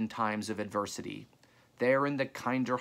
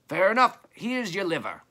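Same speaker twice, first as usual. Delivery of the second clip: loud, high shout across a distance; calling out; 9 semitones higher, 8 dB louder than usual. A narrator's reading. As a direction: call it up the hill, pitched high. Trader calls while throwing goods.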